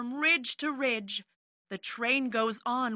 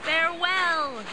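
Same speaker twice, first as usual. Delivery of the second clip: bright, higher call of goodbye; crying farewell; 6 semitones higher, 3 dB louder than usual. A narrator's reading. Dino families call farewell to the travelers, mild cry. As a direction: wave them off with your voice. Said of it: "the dino families cry".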